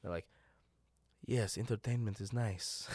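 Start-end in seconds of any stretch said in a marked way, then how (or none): 1.2-2.9 Sham's voice. Retold or imitated. Sham's voice quoted.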